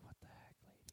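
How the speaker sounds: breathless under-the-breath whisper; whispered aside. Retold or imitated. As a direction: whisper it so she can't hear. Retold whispered aside about a customer.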